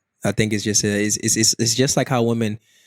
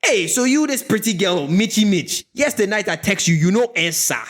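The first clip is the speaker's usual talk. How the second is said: higher, mock African man flirting; African accent; 9 semitones higher than usual. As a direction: chat her up in a playful African accent. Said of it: "that's the way he was talking to my female friend".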